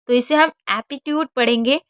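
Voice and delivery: usual speaking voice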